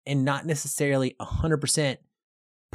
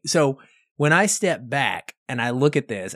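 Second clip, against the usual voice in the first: ordinary talk, nothing marked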